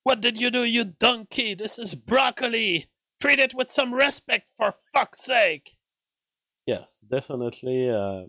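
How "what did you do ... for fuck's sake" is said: high, loud mock chef rant at a cook; angry Gordon Ramsay. Impersonating a chef berating a cook over broccoli, shrill yelled rant. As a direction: yell like a furious TV chef scolding a cook. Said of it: "when he gets pissed and he's like".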